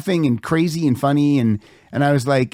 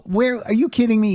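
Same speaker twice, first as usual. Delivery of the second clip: incredulous; 10 semitones higher than usual. Retold or imitated. Reacts to unexpected cake news.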